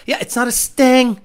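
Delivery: normal talking voice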